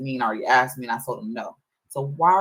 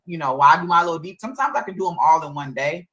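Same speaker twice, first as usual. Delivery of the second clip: usual speaking voice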